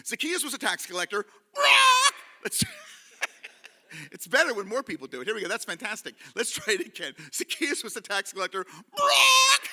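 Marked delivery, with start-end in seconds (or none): 1.6-2.1 loud, high imitated chicken squawk; big comic "brawk". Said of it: "we have to go". 9.0-9.6 loud, high imitated chicken squawk; big comic "brawk". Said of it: "we have to go".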